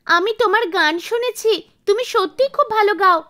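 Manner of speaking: put-on high, eager child voice; a small child asking hopefully; admiring little pupil, pitched high; little girl. Seven-year-old girl asks for lessons.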